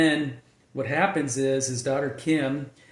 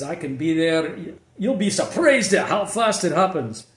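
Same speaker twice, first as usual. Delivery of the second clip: louder, menacing British threat, a loose Liam Neeson take; British accent; 4 semitones higher, 5 dB louder than usual. Retold or imitated. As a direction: growl the threat in a British accent like a tough dad. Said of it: "He says".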